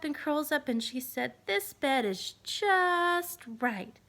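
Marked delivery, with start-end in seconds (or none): 1.5-4.0 put-on very slow, pleased little-girl voice; Goldilocks, content. Goldilocks curling up in the little bed, very slow. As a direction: draw it out, happy and sleepy.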